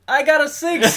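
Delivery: imitating a high, loud victory yell; triumphant shout. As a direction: shout it like a sudden big win. Retold triumphant shout of a dice roll.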